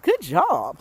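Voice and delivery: high, chirpy robotic praise from a smartwatch; mock watch voice. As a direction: play the fitness watch cheering you on. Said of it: "my watch is saying".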